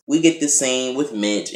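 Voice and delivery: ordinary talk, nothing marked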